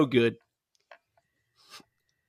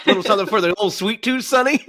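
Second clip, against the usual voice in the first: fast folksy offer, rising higher on Sonny; cheery old man; 4 semitones higher, 5 dB louder, faster than usual. Retold or imitated. Quoting the neighbour holding up leafy brownies, fast folksy cheer. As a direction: sell the brownies like a kindly grandpa.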